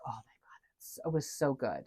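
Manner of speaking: hushed, voiceless gush of delight; breathy whisper. Real, not acted. Fan whispering to a friend about a great scene, awed hush. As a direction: whisper it like the thrill is still with you.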